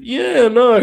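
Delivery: high mocking "Yeah, no" impression; loud, high mimic of a friend; imitating Bob. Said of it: "Bob starts every sentence with".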